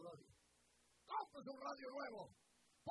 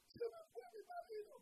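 imitated crying wife. Wife crying over lost husband.

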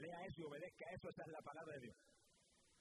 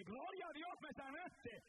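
joyful cry; 10 semitones higher, slower than usual. Retold or imitated. Healed man crying out to God, much higher and elated. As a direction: burst out thanking God in disbelief.

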